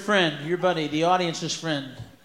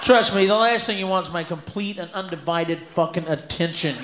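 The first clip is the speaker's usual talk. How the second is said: normal talking voice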